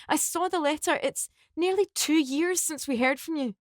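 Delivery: high, upset pleading through tears; tearful shock. Acted. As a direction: cry out his name in disbelief. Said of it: "with tears streaming down her face".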